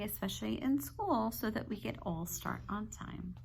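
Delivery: ordinary talk, nothing marked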